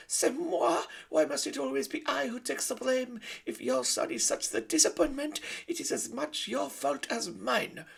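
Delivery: put-on very high, loud French-accented mother; haughty French lady; French mother mouse. Antoinette answering her husband, high French haughty.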